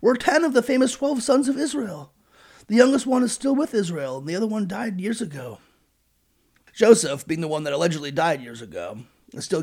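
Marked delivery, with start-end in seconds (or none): 0.0-5.6 high, earnest pleading; mock pleading. Brothers plead innocence to Joseph, voice raised high. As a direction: plead your family's case anxiously. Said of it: "they pleaded".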